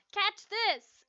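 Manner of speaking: loud, high game shout; yell. A narrator's reading. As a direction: shout it across the court. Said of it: "I yelled".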